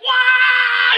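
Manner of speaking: very high shrieked question; mock panic. Boss wailing at the disaster, hammed-up shriek.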